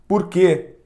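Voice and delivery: usual speaking voice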